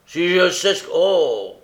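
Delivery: loud, high exclamation; angry cry. A narrator's reading. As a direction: cry out in exasperation.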